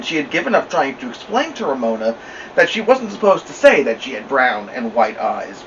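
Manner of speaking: usual speaking voice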